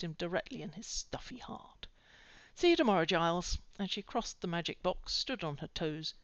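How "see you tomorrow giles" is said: bright goodbye. A narrator's reading. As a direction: call it out warmly. Buffy cheerful goodbye.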